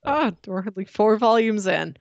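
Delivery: usual speaking voice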